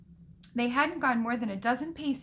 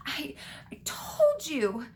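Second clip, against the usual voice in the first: halting gasp, drawn out and higher; 5 semitones higher, slower than usual. A narrator's reading. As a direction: stammer it out slowly, gasping for air. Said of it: "gasped, clutching the stitch".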